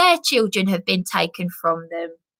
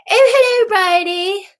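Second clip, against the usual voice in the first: high, louder sing-song hello; mocking posh greeting; 8 semitones higher, 5 dB louder than usual. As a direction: lampoon a smug manageress.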